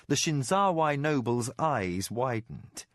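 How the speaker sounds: ordinary delivery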